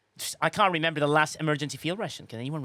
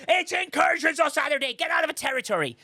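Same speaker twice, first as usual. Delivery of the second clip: much higher, hurried mock call to the troops; mock alliance order; 11 semitones higher, 4 dB louder than usual. Admiral ordering the alliance out of territory, mock-shouted. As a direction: bark it like a fed-up admiral.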